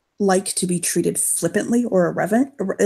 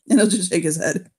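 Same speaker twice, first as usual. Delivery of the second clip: ordinary delivery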